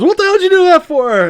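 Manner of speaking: high, loud yelp of outrage; outraged yell. Retold or imitated. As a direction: yell it like you just got slapped. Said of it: "she slaps him".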